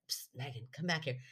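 softly hissing a name to call her back; a quiet psst to pull her friend away; retold sneaky whisper to a friend. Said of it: "no, like".

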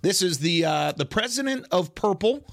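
big playful build-up, high-pitched; mock-grand announcer. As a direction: introduce him like a ring announcer, stretching the name. Mock-grand introduction of caller.

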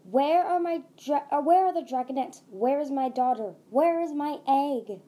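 loud, high, insistent questioning; demanding shout. A narrator's reading. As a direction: demand it like a frantic mother. Angry mother demands her egg.

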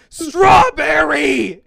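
all-out, high, drawn-out mock scream; increasing yell. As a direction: scream it slowly at the game, imitating uncle. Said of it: "he's just increasingly yelling in volume".

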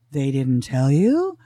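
slow, low-pitched dramatic question. Retold or imitated. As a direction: imitate a doctor's drawn-out, dramatic disbelief. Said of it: "Like, very dramatically".

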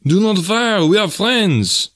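high, urgent call, drawn out at the end; loud cry. A narrator's reading. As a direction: call out to halt a gunman. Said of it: "cried loudly to the man".

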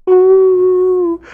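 loud high-pitched ooh squeal; high mock squeal. Host squealing after the butt line, high ooh. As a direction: squeal it teasingly.

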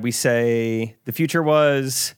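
teasing a future announcement. Acted. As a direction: tease like a coy trailer voice. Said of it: "How do we tease him".

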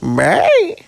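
high, loud parting squeal; Carrie Fisher. Acted. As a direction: sing out a diva's goodbye. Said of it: "Bye, Carrie".

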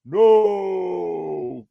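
long, drawn-out wail; hammed-up cry of despair, held long; Vader. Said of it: "as Vader, he's all like".

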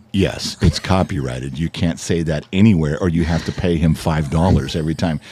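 low, measured, straight-faced ruling; mock-serious deadpan. Teller fooling a friend's wife about a copyrighted name, deadpan. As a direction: lay down a fake rule in a low voice.

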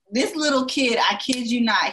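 ordinary talk, nothing marked

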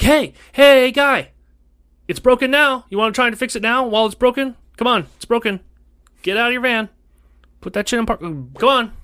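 high, loud call down the driveway; retold shout at the tech to get out of his van; calling after the tech. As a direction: yell after him to come back.

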